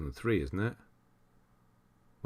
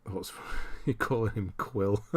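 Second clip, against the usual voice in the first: normal talking voice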